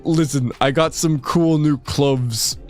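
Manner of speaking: ordinary delivery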